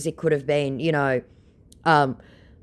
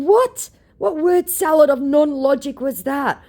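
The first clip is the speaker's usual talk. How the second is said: high, loud disbelieving what; incredulous; 13 semitones higher, 6 dB louder than usual. Real, not acted. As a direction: balk at the nonsense. Incredulous reply to scammer.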